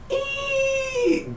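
a very high, thin mock death squeal; dying squeal. Retelling the mom's last moment, a high mock death squeal.

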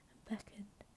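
plain reading voice

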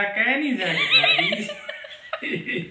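drawn out with a laugh; slow amused aside; slow laughing remark. Real, not acted. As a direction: chuckle the punchline slowly. Husband jokes, laughing.

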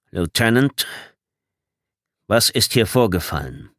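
controlled, curt officer's question; brusque, composed commander; gruff but calm. A narrator's reading. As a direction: ask with restrained authority. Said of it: "zwar schroff, aber ruhig".

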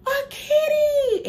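high, loud squealed excitement; imitating the kids squealing at the kitten; mock child squeal. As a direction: squeal it like a thrilled kid. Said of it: "and they was like".

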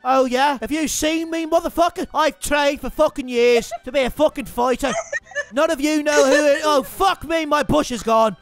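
cocky Irish fighter's rant; Conor McGregor. Acted. Listing his record to hecklers, cocky Irish accent. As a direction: boast and threaten in an Irish brogue.

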